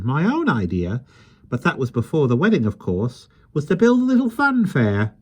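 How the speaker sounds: put-on high, lively voice of a small old man; gentle, chatty little fellow; kindly little man. Mister Mo speaks.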